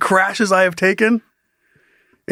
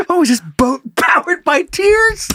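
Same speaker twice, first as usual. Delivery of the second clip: mock sarcastic high; 11 semitones higher than usual. Mocking crying in raft.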